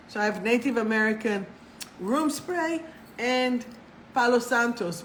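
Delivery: ordinary talk, nothing marked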